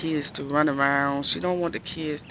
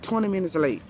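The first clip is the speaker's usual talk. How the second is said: ordinary talk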